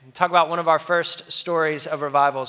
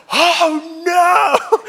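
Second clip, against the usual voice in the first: high, loud panicked oh no; sudden alarmed shout; panicked cry; 12 semitones higher, 7 dB louder than usual. Retold or imitated. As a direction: yelp it out in a panic. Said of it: "And I go".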